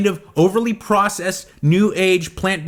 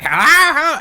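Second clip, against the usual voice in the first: put-on high, loud, quavery old woman on the phone; lightly voiced old lady calling in; old lady caller; 8 semitones higher, 6 dB louder than usual. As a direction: play a confused elderly caller saying hello. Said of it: "And she's like".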